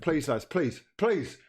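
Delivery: normal talking voice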